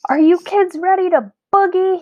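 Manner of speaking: big loud showman call; booming. A narrator's reading. Quincy booms his greeting to the class. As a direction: boom it out to the kids. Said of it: "he boomed".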